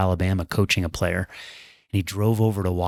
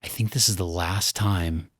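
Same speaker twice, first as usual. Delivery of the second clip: low, hushed sadness; retold bawling, subdued; 3 semitones lower, 5 dB quieter than usual. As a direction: drop softly, almost to a whisper by the end. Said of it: "I just started bawling".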